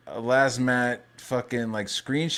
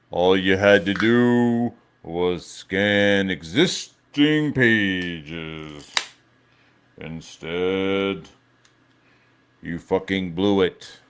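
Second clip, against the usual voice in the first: drawn out, lower movie-villain delivery; slow dramatic mock line; 3 semitones lower, 3 dB louder, much slower than usual. Streamer mocking the game studio in a slow, lower movie voice. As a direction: stretch each word like a film scene.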